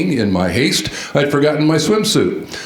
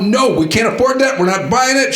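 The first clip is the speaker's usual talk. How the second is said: imitating a mom snapping, much higher; a mock sharp refusal, pitched up; retold snapping; 11 semitones higher than usual. Mom refuses candy to her son, mock snapping and higher. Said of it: "she snapped at him".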